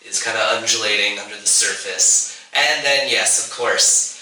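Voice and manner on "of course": ordinary delivery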